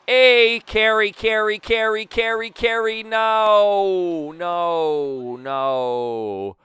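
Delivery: loud high chant sliding down into long noes; frantic pleading, then dismay. Real, not acted. Player willing the ball to carry, frantic then crushed. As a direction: beg it loudly, then wail as hope falls away.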